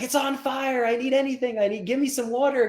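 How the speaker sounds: very high mock panic; panicked owner. Car owner begging passing strangers for water, high mock panic. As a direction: beg for anything to throw on it.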